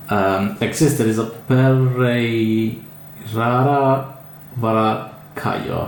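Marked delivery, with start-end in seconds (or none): 1.5-5.9 "Pereirara Varacaio" sounded out slowly with stretched vowels; "Pereirara Varacaio" in careful, unsure syllables; tentative name pronunciation. Real, not acted. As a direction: feel your way through "Pereirara Varacaio", drawing it out. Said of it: "I think it's pronounced".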